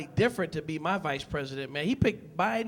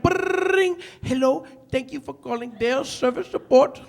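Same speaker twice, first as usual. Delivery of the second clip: lightly put-on phone support agent, higher; mock customer-service greeting with a ring; call center operator; 4 semitones higher, 4 dB louder than usual. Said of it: "You call up there, it's like".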